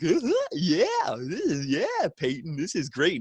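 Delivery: high drawn-out awkward uh-huh, then back to normal; hammed-up awkward approval; awkward Shane McMahon. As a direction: play the cringing boss putting an arm around her. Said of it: "he's like".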